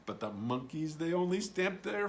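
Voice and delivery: ordinary delivery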